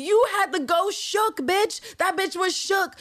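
high-pitched, indignant scolding; exasperated rant. Real, not acted. Fan scolding Villanelle over the shaken woman, exasperated rant. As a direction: lay into her, pitched well up. Ranting at the character in outrage.

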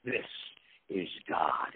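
lightly spooky killer voice; mock Freddy Krueger. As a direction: make it sound like Freddy Krueger. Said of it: "almost like Freddy Krueger".

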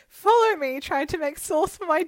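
loud, high, giggling through it; laughing while quoting her Snapchat; laughing mock quote. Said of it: "We're like".

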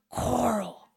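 soft mock Rick Grimes "Coral"; mock "Coral" with a wet, spitty drawl; understated Rick Grimes "Coral". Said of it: "it's like he says".

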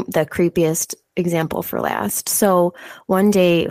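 ordinary talk, nothing marked

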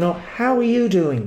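sincere, lilting "Oh, how are you doing?"; genuinely concerned "Oh, how are you doing?"; caring inflection. Shown as a demonstration. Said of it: "with inflections going".

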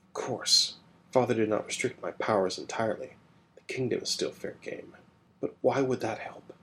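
soft whispered aside; whispered reply. Acted. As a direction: answer under your breath, secretive. Apostos answers in secret.

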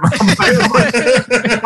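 ordinary talk, nothing marked

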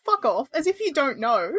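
usual speaking voice

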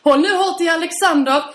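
hammed-up storm warning from mum; mock dramatic mother.

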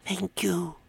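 put-on soft, high little-kid voice; small obedient squeak; child. A narrator's reading. Child thanking the Hogfather on cue, soft high voice. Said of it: "said the child".

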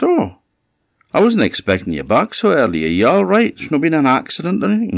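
high, anxious wife voice; surprised old woman. Acted. Old woman greets husband home early, surprised and worried. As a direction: fuss in a high, swooping voice, quickening with worry.

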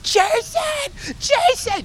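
high-pitched yelled cheer for her son; mom cheering. Retold or imitated. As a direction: shout his name from the sidelines like a mom. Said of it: "I remember her cheering".

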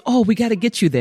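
high-pitched rallying helper; imitated eager urgency. Cab driver rushing a new grandmother, imitated urgency. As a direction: jump to help, voice lifted high.

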